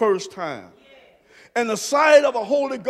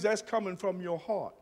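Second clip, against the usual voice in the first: soft refrain; 5 semitones lower, 13 dB quieter than usual. Acted. Refrain, the first take quiet.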